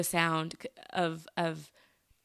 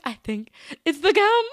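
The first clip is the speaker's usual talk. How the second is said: excited realization; 13 semitones higher, 7 dB louder than usual. Retold or imitated. Realizing gum causes sass.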